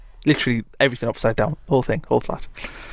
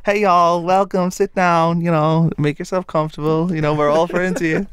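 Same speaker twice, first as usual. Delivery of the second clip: American counsellor; 5 semitones higher than usual. Retold or imitated. Counsellor welcoming newcomer to the circle, cheery American voice.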